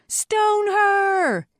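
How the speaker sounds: high, loud mob yell; crowd's answering cry. A narrator's reading. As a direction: scream it from within a mob. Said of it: "the crowd answered back".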